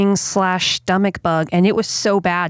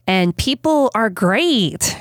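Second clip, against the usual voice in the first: drawn-out, higher-pitched sarcasm; heavy sarcasm; 4 semitones higher, slower than usual. Real, not acted. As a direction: stretch it out with great sarcasm. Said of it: "she says with great sarcasm".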